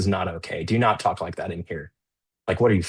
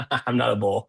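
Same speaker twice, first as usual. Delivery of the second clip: amused, with a chuckle going in; chuckle, then toss off the joke; laughing; 2 semitones higher than usual. Real, not acted. Laughs while joking about bears.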